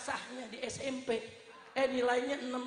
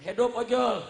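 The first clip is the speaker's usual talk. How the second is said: loud cheer at usual pitch, held back; moderate cheer; 12 dB louder than usual. Real, not acted. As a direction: call out the cheer without full force. First cheer, before louder repeat.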